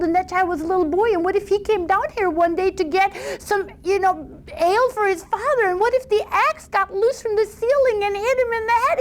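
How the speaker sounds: put-on high, rising, worried girl's voice climbing to a squeal; a foolish young woman working herself into a panic; silly fretful daughter. Silly daughter frets over axe.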